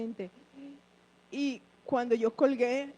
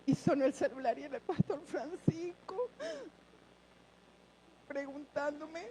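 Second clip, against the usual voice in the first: crying; 3 semitones higher than usual. Real, not acted. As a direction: voice cracking with tears. Crying while telling.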